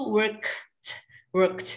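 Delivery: "worked" with the K released, then a T; K sounded before T. Shown as a demonstration. Teacher demonstrating the cluster, "worked" with clear K-T. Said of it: "K-nya dibaca dulu".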